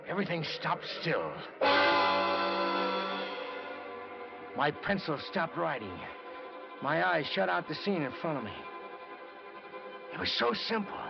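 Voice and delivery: tense monologue as a dark idea takes hold; tense realization. Acted. Narrator seized by murderous idea.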